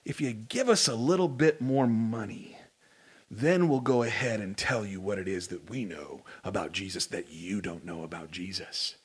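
low, hushed, sly voice of a greedy teacher; dropping low and soft like a con man; false teacher. Retold or imitated. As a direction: lean in low like a huckster selling secrets. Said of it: "these false teachers would go".

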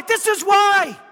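loud, shouted emphasis; a preacher shouting his point to the church. Acted. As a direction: shout the line out. Repeated line, shouted.

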